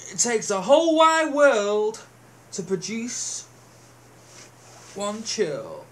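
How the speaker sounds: booming, mock-solemn slogan read; mock grand slogan. Mocking a bushcraft slogan, booming then drawn-out. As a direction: pronounce it like a grand motto, then slow right down.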